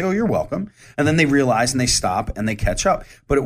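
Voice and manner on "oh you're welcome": sarcastic. Retold or imitated. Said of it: "I'm the kind of sarcastic asshole".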